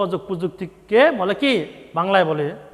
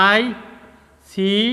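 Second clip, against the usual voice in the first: slow; much slower than usual. Shown as a demonstration. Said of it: "teacher dictating answer slowly".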